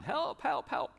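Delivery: high, frantic mock cries of help. Hobbits running around crying out, high frantic help. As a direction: squeal it like a panicked hobbit. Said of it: "they just are running around".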